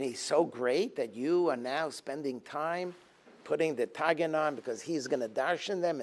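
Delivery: usual speaking voice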